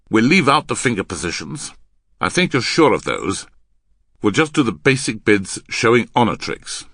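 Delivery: loud, firm concession; domineering man. Acted. Man conceding a shorter practice, still domineering. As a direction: instruct the partner briskly and forcefully. Voices Henry instructing his partner.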